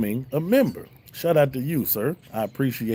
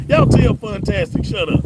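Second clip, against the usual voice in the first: high, loud teasing squeal; playful mock shout; 7 dB louder than usual. Streamer teasing a supporter in chat with a squealed shout. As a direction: yelp it at the chat like a joke.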